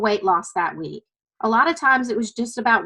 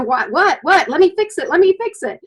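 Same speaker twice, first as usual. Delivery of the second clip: higher, louder panicked fix-it husband; hammed-up helpless husband rushing to fix it; mock husband frantic to make his crying wife feel better; flustered husband; 5 semitones higher, 5 dB louder than usual. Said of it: "You know how men are".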